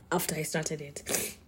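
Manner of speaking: sudden soft unvoiced whisper. Real, not acted. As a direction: drop to a breathy aside. Trailing confession, dropped to whisper.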